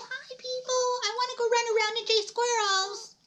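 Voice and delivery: very high, cutesy voice for a little dog; talking dog voice. Pretend. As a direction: speak as the excited pup, squeaky high. Speaks for her dog.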